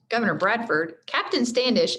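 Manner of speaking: usual speaking voice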